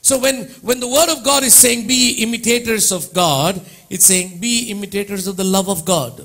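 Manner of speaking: preacher stretching each word; fervent preaching. Acted. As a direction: proclaim it from the pulpit. Preaching at full force.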